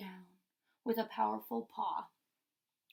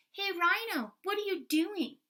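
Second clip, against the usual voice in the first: porcupine yelling; 8 semitones higher, 5 dB louder than usual. Acted.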